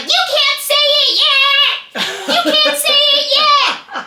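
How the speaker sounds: very high, loud, slow sing-song "you can't say it yet"; chanting child telling off mom; shrill protesting kid. Retold or imitated. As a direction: play the indignant daughter. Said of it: "she's like".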